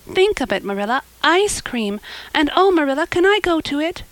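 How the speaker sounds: put-on eager girl pleading to go; Anne. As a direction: gush out thrilling news to a guardian. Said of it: "she exclaimed breathlessly".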